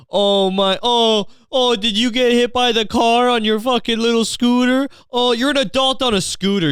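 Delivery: high, loud mock-sympathy whine; exaggerated fake sobby taunt; mocking fake crying. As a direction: jeer at them like a crying baby. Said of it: "fake crying in the front of the person and just mock them".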